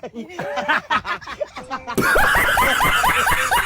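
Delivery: shrieking laugh, high and loud; real laughing fit; loud burst of laughter. Real, not acted. Laughing at tatay's face.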